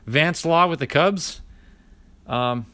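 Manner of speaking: ordinary delivery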